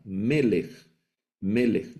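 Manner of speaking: Melech, melech, each stressed on the first syllable, falling; accent the first of the two syllables in melech; stress on penultimate syllable. Shown as a demonstration. Teacher demonstrates the accent, melech stressed on next-to-last syllable. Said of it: "el acento va en la penúltima sílaba".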